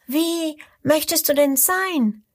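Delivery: high, light little crocodile voice; a small bright creature suggesting a new name. A narrator's reading. Little crocodile asks back.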